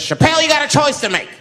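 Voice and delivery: high-pitched, mock threatening gunman; gunman threat. Gunman to his hostage, threatening. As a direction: put on a high-voiced gunman laying down an ultimatum. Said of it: "puts a gun in my head and says".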